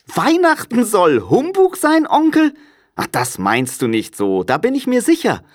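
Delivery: very high, lively, astonished voice; jolly young relative, taken aback; cheerful nephew, incredulous. A narrator's reading. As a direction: protest brightly in disbelief. Nephew's voice in the story.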